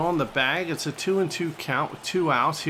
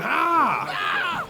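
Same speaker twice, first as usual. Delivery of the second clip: imitated scream; 12 semitones higher than usual. Gamer mimicking his player's celebration scream, mock yell. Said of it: "He's screaming".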